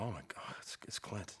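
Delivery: near-whispered to himself; breathy, very soft private exclamation; awed hushed thought. Retold or imitated. Actor to himself, spotting Clint across the street, awed whisper. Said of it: "I kinda glanced over and thought".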